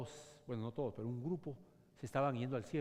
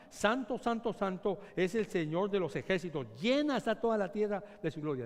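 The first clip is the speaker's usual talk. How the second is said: proclaiming; 5 semitones higher, 6 dB louder than usual. Acted. Preacher proclaims the seraphim's cry.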